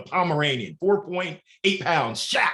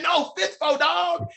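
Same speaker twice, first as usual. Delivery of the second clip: higher, put-on friend voice; imitating friend; 12 semitones higher, slower than usual. The runner explaining the saying, higher put-on voice. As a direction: correct him, playing the friend.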